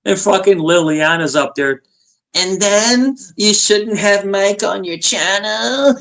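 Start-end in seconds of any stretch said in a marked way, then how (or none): 2.3-6.0 high, dragged-out mock nagging voice; whiny, drawn-out imitation of a nag; mocking complaint. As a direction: mimic a scold, stretching every word out high. Mocking quote of a woman's complaint.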